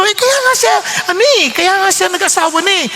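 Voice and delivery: heated reply. Retold or imitated. Arguing with wife.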